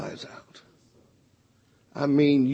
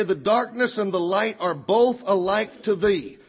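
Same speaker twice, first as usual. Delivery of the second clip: scripture reading; 5 semitones higher than usual. Acted. Preacher reading Psalm to congregation, solemn lifted reading. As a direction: read the psalm aloud with reverent weight. Reads Psalm verses aloud.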